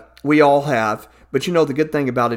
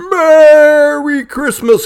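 slow, loud, high Santa greeting; 11 semitones higher, 8 dB louder, much slower than usual. Acted. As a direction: stretch it out like Santa.

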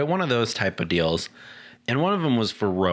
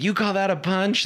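put-on high-pitched taunting kid; retold jeer, pitched up in disbelief; mocking; 9 semitones higher than usual. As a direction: make fun of a feeble punch. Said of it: "making fun of me".

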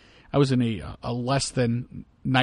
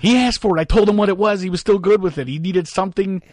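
higher and louder, insisting he did nothing wrong; emphatic raised defense; 5 semitones higher, 4 dB louder than usual. Retold or imitated. To his shocked companion, a raised, insistent defense. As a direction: make the case loudly, as if it were obvious.

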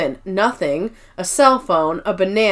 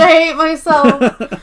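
laughing; 5 semitones higher, 4 dB louder, slower than usual. Real, not acted. Laughing at her own mix-up.

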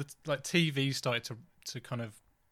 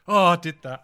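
loud, raised groan of remembered shame; cringing regret; 4 semitones higher, 10 dB louder than usual. Retold or imitated. Waking at three in the morning remembering, loud cringing regret. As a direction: relive the embarrassment with a loud mock wail.

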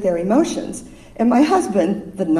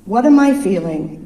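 usual speaking voice

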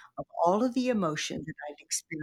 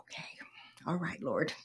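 whisper; 8 dB quieter than usual. Retold or imitated. Surrendering to God, at night.

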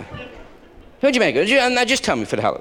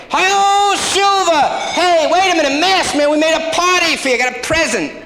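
calling after him; 10 semitones higher than usual. Acted. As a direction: holler to stop him from leaving. Neighbour calling after the departing hero.

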